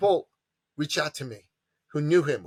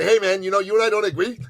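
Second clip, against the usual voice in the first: a hammed-up petty tyrant, higher and louder; mock tyrant; 7 semitones higher, 6 dB louder than usual. Mocking a tyrant who punishes his critics, higher and louder. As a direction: sneer it like a gleeful tyrant, laughing at the end. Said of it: "if I'm in power to say".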